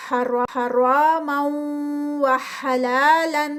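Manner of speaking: slow حلالا with a stretched لا; Quranic recitation. Shown as a demonstration. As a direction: chant it slowly as a tajweed drill. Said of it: "ادغام ہے".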